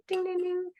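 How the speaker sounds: playful mock toast call; glass-tapping announcement. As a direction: mimic a host tapping a glass for attention. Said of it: "and they go".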